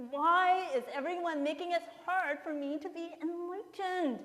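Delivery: loud, high, exasperated cry to the sky; exasperated outcry. Retold or imitated. Husband cracking under chaos at home, crying out loud and high. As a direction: wail it in loud comic despair. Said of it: "And he's like".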